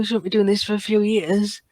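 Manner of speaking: normal talking voice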